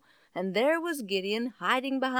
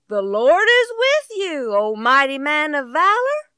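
angel's greeting; 4 semitones higher, 7 dB louder than usual. Acted. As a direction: announce it like a messenger from heaven.

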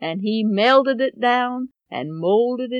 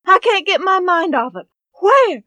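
higher, louder desperate wail; frantic mother; 7 semitones higher, 5 dB louder than usual. Acted. Mother hunting her lost money, desperate high cry. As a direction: cry it out, at her wits' end.

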